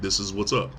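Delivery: low, quiet matter-of-fact quote; understated, unemotional retelling; imitated emotionless explaining. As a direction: explain it with no feeling, softly. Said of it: "showing no real emotion".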